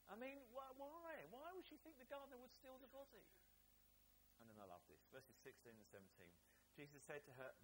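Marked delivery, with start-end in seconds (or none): 0.1-3.2 why on earth, exasperated; incredulous exasperation. Real, not acted. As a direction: voice jumps up high in disbelief. Preacher's incredulity at Mary.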